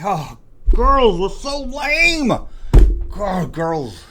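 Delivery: exasperated whine. Acted. Exasperated groan.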